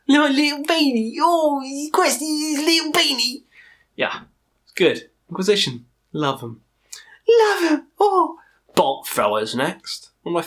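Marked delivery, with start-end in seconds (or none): 0.1-3.4 put-on much higher squeaky baby voice; high pet voice. Speaking for the pet who wants its beanie, squeaky. 7.3-8.4 sudden high loud yell of Love 'em; shout. Real, not acted. Shouting love for the band, high and loud. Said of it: "Love 'em".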